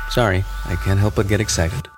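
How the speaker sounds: ordinary delivery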